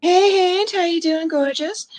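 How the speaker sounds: high, loud gushing hello; excited greeting. Real, not acted. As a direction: gush over a friend arriving. Greeting a chat member.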